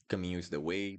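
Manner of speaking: normal talking voice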